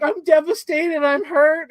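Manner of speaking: high, drawn-out mock upset; hammed-up wounded plea; mock devastation. Acted quote of upset character.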